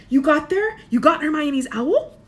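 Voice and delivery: high, loud eager questions; Harry quizzing Dumbledore; eager amazement. A narrator's reading. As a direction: ask in excited disbelief.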